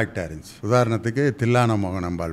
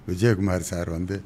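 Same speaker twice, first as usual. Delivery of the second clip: ordinary talk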